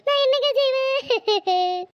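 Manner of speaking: slow, drawn-out helpless cry; theatrical sobbing plea; tearful wail. Acted. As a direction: stretch it out and break into sobs. Wailing to husband about sister.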